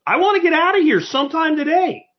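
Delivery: high, fed-up mock rant; exasperated. A shopper stuck in line, pitched high in exasperation. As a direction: let your impatience spill over. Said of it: "I'm like".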